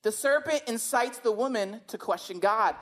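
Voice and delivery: ordinary talk, nothing marked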